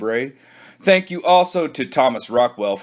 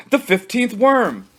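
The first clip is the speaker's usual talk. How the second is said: higher, thrilled narration; excited exclamation, higher; 10 semitones higher than usual. A narrator's reading. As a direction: announce it like a triumph. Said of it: "The worm!".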